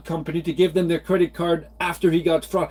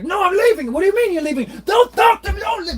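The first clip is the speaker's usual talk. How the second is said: mock hysterical yelling; 13 semitones higher than usual. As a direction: scream it like a man bolting. Imitating father storming out.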